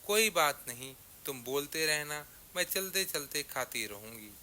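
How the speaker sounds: usual speaking voice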